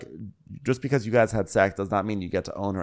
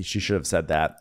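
ordinary talk

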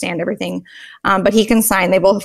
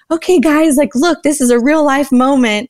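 put-on excited to the kids; 5 semitones higher, 4 dB louder than usual. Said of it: "And I was like".

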